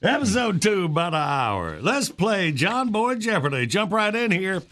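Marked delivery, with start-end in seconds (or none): none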